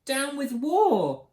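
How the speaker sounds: high, slow, drawn-out call; hooting. A narrator's reading. Drip gets the chant wrong, high hoot. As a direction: hoot it like a small dinosaur. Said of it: "And he hooted".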